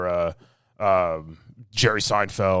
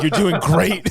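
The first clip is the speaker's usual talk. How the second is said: reassuring crew; 9 semitones higher, 6 dB louder, slower than usual. Retold or imitated. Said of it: "It's like".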